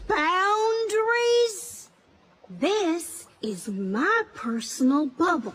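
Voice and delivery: very slow, stretched "Boundaries?"; drawn-out indignation. Acted. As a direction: stretch it out to scold him. Pushing brother out of her space.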